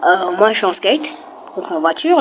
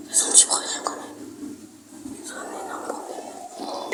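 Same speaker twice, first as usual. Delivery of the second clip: hushed worried whisper; 15 dB quieter than usual. Real, not acted. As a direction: breathe it out as a secret worry. Worried aside about being banned.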